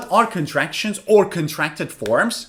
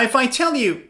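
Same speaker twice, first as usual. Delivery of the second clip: usual speaking voice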